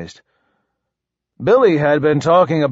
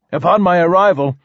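plain reading voice

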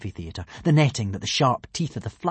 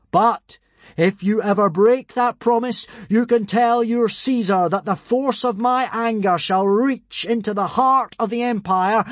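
high, loud godly proclamation; thunderous oratory, slowing to a close; booming final speech; 9 semitones higher, 4 dB louder than usual. A narrator's reading. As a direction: deliver a grand warning to an empire. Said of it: "made his final speech".

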